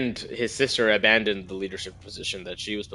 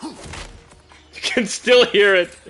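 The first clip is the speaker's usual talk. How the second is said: high, loud and drawn out; delighted, slow high exclamation; amused disbelief; 11 semitones higher, 5 dB louder, much slower than usual. Real, not acted. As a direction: squeal it slowly, about to crack up. Amused the loop still sounds.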